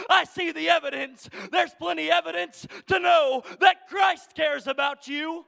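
high, loud sermon delivery; impassioned preaching. Real, not acted. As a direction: drive each phrase home loudly to the congregation. Preacher at full force.

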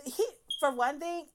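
ordinary talk

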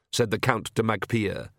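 ordinary delivery, nothing marked

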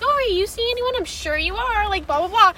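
high, prying coworker voice; nosy coworker asking questions. Retold or imitated. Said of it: "and Janet's like".

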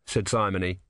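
usual speaking voice

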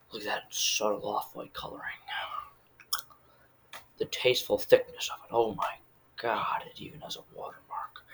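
breath-only mock-Bateman awe; whispered inner monologue. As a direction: whisper it as a private, fixated thought. Acting Bateman's awed inner monologue.

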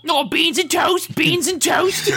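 high, slow mock English accent with a laugh; English parents. Friends mocking the Englishman's parents: high mock English accent. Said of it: "mimic his English parents".

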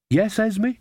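high-pitched, guileless questioning reply; innocent. A narrator's reading. Nanny caught behind Granny, answering innocently. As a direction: answer high, as if you'd done nothing. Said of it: "said Nanny Ogg innocently".

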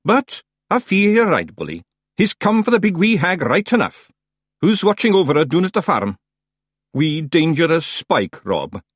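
high, loud Scottish voice; worried wee clan chief taking stock; Rob the Feegle chief. A narrator's reading. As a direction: question your clansman in a high Scots voice.

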